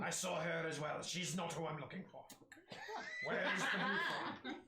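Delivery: agitated, rising to a shout; frustrated dwarf. Acted. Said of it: "frustrated face on Pete".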